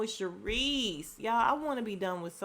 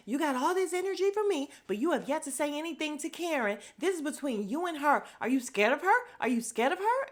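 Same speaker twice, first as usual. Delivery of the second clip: high, fast, heated mock tirade; going off; 8 semitones higher, 3 dB louder, faster than usual. Wendy going off at Charisse, imitated heated rant. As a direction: retell her fired-up confrontation, pitched high. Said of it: "going off for no good reason".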